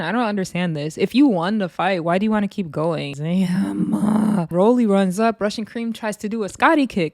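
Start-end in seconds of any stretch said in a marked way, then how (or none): none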